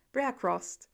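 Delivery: ordinary delivery, nothing marked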